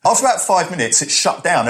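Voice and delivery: ordinary talk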